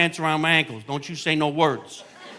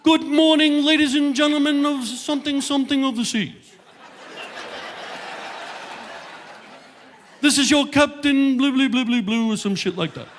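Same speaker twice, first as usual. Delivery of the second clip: ship captain announcement; 10 semitones higher than usual. Retold or imitated. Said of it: "captain comes over the loudspeaker".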